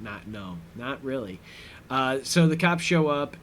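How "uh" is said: usual speaking voice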